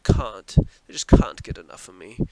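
"can't" said the British way, with a broad "ah"; British accent. Shown as a demonstration. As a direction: try a British "can't", vowel as in "father". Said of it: "That's how they say it, right?".

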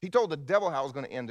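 booming sermon build; preaching at full force. Acted. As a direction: proclaim it from the pulpit.

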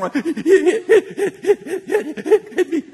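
high, loud mock sobbing gasps; hammed-up child crying in rapid gulps; hyperventilating crying. Grandpa asks what's wrong, she answers only hyperventilating. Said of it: "she's hyperventilating".

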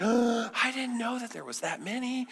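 high, scandalized neighbor voice; mock shock. Neighbors' gasping shock, acted.

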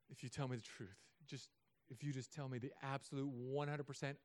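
soft, calm offer of forgiveness; father reassuring his lying child; gentle reassurance. Retold or imitated. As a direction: coax the truth out gently. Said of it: "I say".

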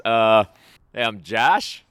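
mock Boston accent, high and loud, ribbing a colleague; Boston Josh impression. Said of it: "I'm gonna do the Boston version".

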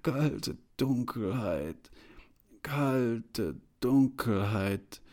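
slow, halting, drawn-out words; feeble stammer. A narrator's reading. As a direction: grope slowly from word to word. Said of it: "ich stammelte".

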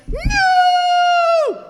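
long, high wailing "no"; horrified yell. Retold or imitated. Dad saving his cups from the dumpster, horrified yell. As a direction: scream it in panic, drawn right out.

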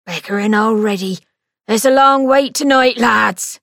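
loud call to the waiting queue; attendant calling out. A narrator's reading. Attendant calling to the queue of students, loud. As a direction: shout down the line of students.

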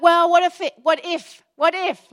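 loud, high, fretful what-ifs; hammed-up anxious hesitation; mock worried dithering. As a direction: stammer through the doubts like a nervous overthinker. Mimicking anxious overthinker.

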